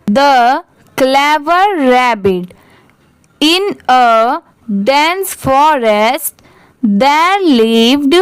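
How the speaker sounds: very slow, with long pauses between phrases; slow model reading. Shown as a demonstration. Teacher models the paragraph for children to repeat, very slow. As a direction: read each phrase slowly for students to repeat. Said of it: "आप भी मेरे बाद repeat करिए".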